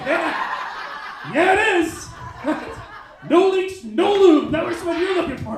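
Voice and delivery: high, loud triumphant retort; hammed-up loud self-justifying; shouted defiant comeback. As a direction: shout back as if it solves everything.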